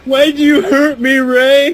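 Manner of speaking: high, whiny plea; hammed-up hurt friend; mock wounded wrestler. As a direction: sound betrayed and wounded.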